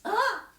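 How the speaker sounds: high, loud burst; punchy high exclamation; heroic "aha". Shown as a demonstration. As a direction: announce the prince triumphantly. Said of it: "you go".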